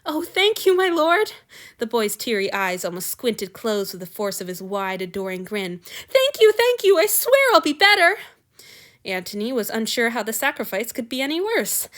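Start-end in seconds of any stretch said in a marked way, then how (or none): 0.0-1.3 high, louder, gushing thanks; tearful gratitude. Acted. Relieved boy thanks his lord, high and gushing. Grateful boy through tears. 6.1-8.2 high, louder, gushing thanks; tearful gratitude. Acted. Relieved boy thanks his lord, high and gushing.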